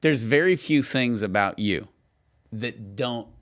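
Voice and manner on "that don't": normal talking voice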